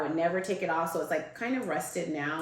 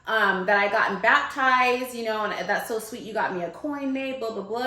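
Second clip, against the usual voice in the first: higher, louder retold insistence; insistent explaining; 6 semitones higher, 5 dB louder than usual. Caller reminding puzzled friend about the coin, insistent. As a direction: press the point, pitched up.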